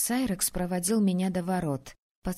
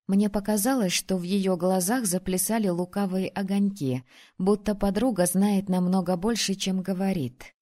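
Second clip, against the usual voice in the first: ordinary delivery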